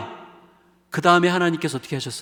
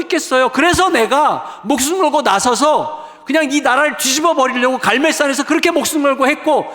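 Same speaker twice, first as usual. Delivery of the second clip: high, loud pleading protest; acted Elijah shouting his despair, much higher and louder; Elijah's desperate complaint; 9 semitones higher, 6 dB louder than usual. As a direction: cry out to God as if at the end of your rope. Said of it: "엘리야가 하나님 앞에 뭐라고 얘기합니까".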